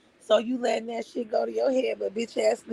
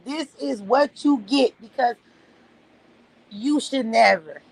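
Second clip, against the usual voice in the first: usual speaking voice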